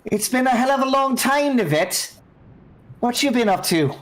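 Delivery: put-on high, breezy, teasing greeting to an old friend; high-voiced captain greeting an old comrade; captain NPC voice. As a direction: pitch it way up and purr the tease.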